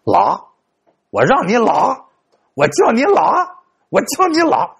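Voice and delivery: a hammed-up old man cursing in the street; old man yelling. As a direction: bellow it at the culprit, rising each time. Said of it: "就看这老头在这喊".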